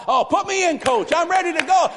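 acted fired-up eagerness; pumped up. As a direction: burst out like a player begging to get in. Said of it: "[name]'s getting pumped".

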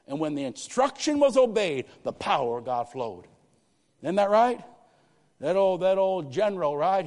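loud, high, fervent pulpit preaching; full-force preaching. Real, not acted. Preacher rousing the congregation about obedience, full-force preaching. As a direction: preach it with rising fire.

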